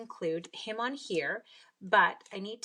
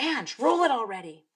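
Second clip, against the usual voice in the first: impatient viewer; 4 semitones higher, 8 dB louder than usual. Retold or imitated. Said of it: "You're like".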